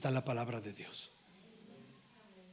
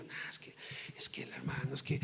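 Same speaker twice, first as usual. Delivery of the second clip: mock muttered excuses; 8 dB quieter than usual. Preacher mimicking those whom the word offends, muttered excuses. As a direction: imitate offended listeners, hushed and half-voiced.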